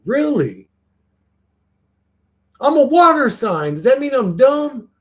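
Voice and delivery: high, loud indignant protest; mock offended. Reacting to chat calling air signs smartest, mock indignant. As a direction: play hurt at being called dumb.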